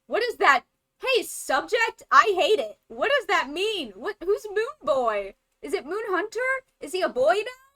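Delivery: high, loud, exasperated questions; worked-up disbelief; baffled ranting. Real, not acted. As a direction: fire off bewildered questions, pitch swooping up. Keeps demanding who Moon Boy is.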